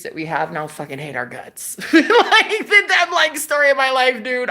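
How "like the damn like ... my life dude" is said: loud, high burst of laughing speech. Real, not acted. Relating hated country to own life, laughing loudly. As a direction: crack up while getting the words out.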